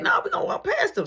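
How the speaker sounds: high, fast, cocky voice; brother's bravado. Retold or imitated. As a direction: voice the brother daring them on. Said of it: "So Marky like".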